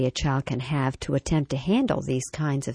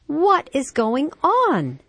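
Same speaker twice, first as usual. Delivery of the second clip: drawn-out, high, louder exasperated question; exasperated mom; 11 semitones higher, 5 dB louder, much slower than usual. A narrator's reading. Mom to her racing five-year-old, exasperated. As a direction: stretch each word out in a mother's exasperation. Story mom driven to distraction.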